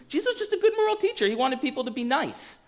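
very high, fast mock voice; high-pitched caricature of a naive claim; mocked skeptic. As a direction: squeak it out in a dismissive singsong parody. Mimicking a skeptic's view of Jesus.

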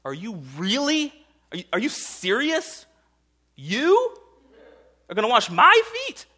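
building from doubt to astonished cry; incredulous Peter. Retold or imitated. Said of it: "and Peter goes".

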